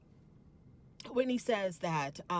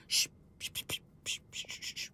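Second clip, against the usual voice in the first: whispered shushing, trailing off softer; hushing whisper; 3 dB quieter, faster than usual. Retold or imitated. Mary shushing Lisa to silence, whispered hush. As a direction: shush her down like a child.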